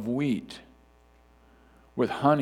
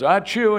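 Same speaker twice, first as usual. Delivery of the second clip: playful imitated sneeze, higher; hammed-up ah-choo; mock sneeze; 9 semitones higher, 6 dB louder than usual. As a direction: act out a quick sneeze. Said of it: "So when someone goes".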